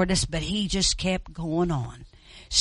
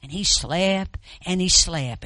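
ordinary delivery

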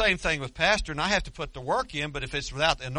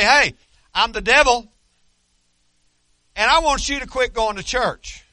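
a loud, higher mock devil announcing himself; brash devil voice; 9 semitones higher, 11 dB louder than usual. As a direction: play the devil boasting openly, hammed up. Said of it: "He won't just come up to you and say".

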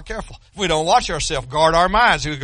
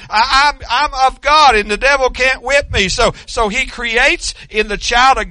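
higher and louder, driving proclamation; preaching at full force; 6 semitones higher, 5 dB louder than usual. Acted. Preacher proclaims salvation to the church, full force. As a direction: preach it hard, claiming every line.

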